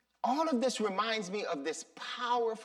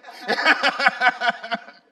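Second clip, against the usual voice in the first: loud laugh; 4 semitones higher, 11 dB louder than usual. Real, not acted. Laughs after almost swearing.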